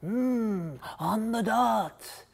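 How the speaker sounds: soft, slow satisfied mutter; quiet self-satisfied mutter; muttering to himself. Acted. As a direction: murmur it under the breath, slow. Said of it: "he tuttered to himself".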